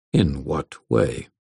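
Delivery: low, cool and menacing; cold Svartalf voice. A narrator's reading. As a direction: weigh each word coldly.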